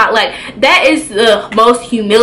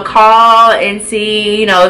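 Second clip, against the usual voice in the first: ordinary talk, nothing marked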